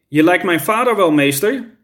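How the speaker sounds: ordinary delivery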